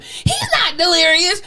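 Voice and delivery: high, drawn-out imitation of an outraged daughter; slow, high-pitched disbelieving cry; mock indignant protest. Retold daughter indignantly contradicting the therapist.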